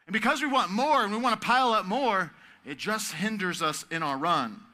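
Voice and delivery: preaching full force. Acted. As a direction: build the list with fire.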